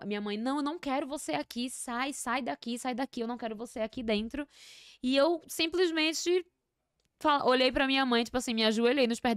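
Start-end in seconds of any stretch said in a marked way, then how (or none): 0.4-4.4 higher, softer imitation of an upset mother; retold insistent order to leave; mock-distressed rejection, pitched up; agitated refusal. Acts mother throwing father out.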